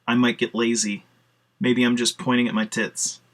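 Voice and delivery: ordinary talk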